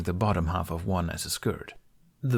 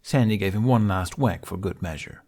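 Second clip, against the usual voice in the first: plain reading voice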